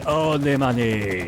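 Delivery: slow, loud Watto line. Pretend. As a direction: lean into the greed. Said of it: "supposed to be doing that in, um, Watto's".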